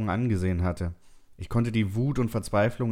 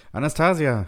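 loud, higher call of a name; 7 semitones higher, 7 dB louder than usual. A narrator's reading. Narrator calls to Anastasia from afar, calling out. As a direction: call across a distance to her. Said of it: "rief ich ihr zu".

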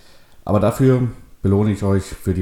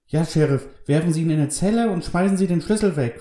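put-on high-pitched, insistent child; boy's high voice, insisting; demanding boy; 11 semitones higher than usual. A narrator's reading. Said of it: "forderte der Junge".

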